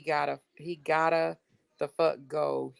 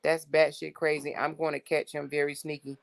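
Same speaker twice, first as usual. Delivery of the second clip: ordinary talk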